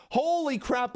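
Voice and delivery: usual speaking voice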